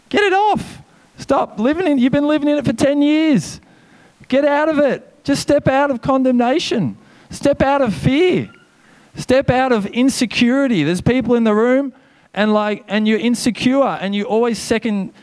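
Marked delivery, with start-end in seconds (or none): 0.1-10.8 high, urgent preaching; pleading pastor's call; fervent exhortation. Real, not acted. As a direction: urge them to step free.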